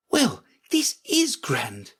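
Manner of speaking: high, slow, savouring voice; awed Alice. Acted. Alice finding she is queen, high slow wonder.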